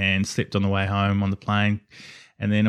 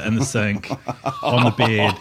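laughing through the words. Real, not acted. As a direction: giggle while listing. Laughs while telling.

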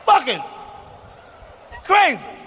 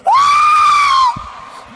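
very high, loud hammed-up scream; mock scream; 8 dB louder than usual. Comic imitates women screaming in bed, piercing mock scream. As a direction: scream like an exaggerated woman in bed. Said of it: "They be screaming".

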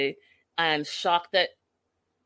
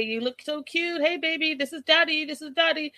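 put-on high cooing dad voice to a baby; mock baby talk; 8 semitones higher than usual. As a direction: coo at the baby in a raised pitch, mocking him. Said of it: "You just call and be like".

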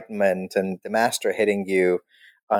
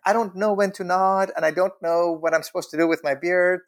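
higher, helpless mock complaint; pitched-up confusion, played for humour; clueless complaint; 7 semitones higher, faster than usual. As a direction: whine it lightly as the lost newcomer. Acted clueless student's complaint.

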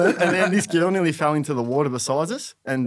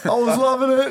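mock delight; 12 semitones higher, 4 dB louder than usual. Mocking friend's delight.

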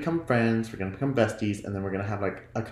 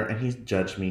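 ordinary delivery

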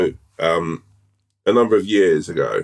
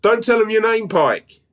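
high shouted call; shouting; 14 semitones higher than usual. Retold or imitated. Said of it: "And I went".

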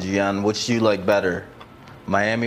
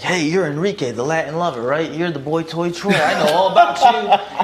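mock fan greeting; 8 semitones higher, 3 dB louder than usual. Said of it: "he was like".

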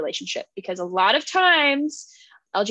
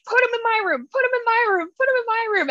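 high, insistent repeated plea; frantic pleading; 10 semitones higher, faster than usual. Retold or imitated. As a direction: beg it over and over, pitched up. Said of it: "He's like".

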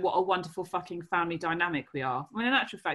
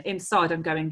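normal talking voice

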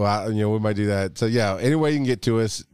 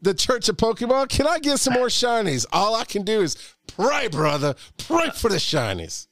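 preacher voice; 8 semitones higher than usual. Pretend. Preacher voice resumes for prayer line.

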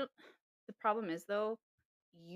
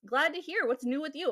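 higher, louder mock of a pushy texter; mock eager guy; 7 semitones higher, 6 dB louder than usual. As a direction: parody his chirpy reply, pitched up. Mocking the guy's eager texts.

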